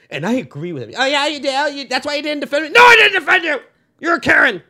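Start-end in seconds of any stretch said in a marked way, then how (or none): 1.0-2.7 high, loud accusing partner; hammed-up aggrieved accusation; mock quarrel. Acts out couple's future quarrel. 2.7-4.6 very loud, high quarrel yell; hammed-up shouted retort; angry comeback. Acts out man yelling back in quarrel.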